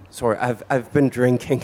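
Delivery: breaking into real laughter; sheepish words running into a laugh; laughing apology. Real, not acted. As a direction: confess it and dissolve into a laugh. Laughs while apologising for fumbling.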